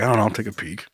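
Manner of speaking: low, soft pilot voice; cargo plane captain. Acted. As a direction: murmur it low like a casual captain. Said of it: "he's like".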